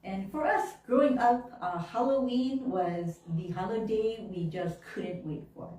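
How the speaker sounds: normal talking voice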